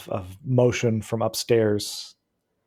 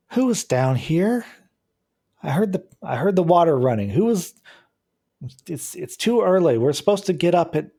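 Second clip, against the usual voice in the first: female voice; 6 semitones higher than usual. Acted. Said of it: "a female voice say".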